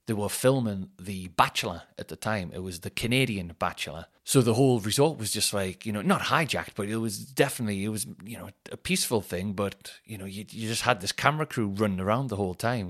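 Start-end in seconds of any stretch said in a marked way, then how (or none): none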